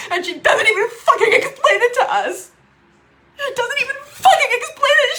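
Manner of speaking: high, loud, cracking on the curse; tearful fury. Real, not acted. Sister never explains, angry crying. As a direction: rage through the tears.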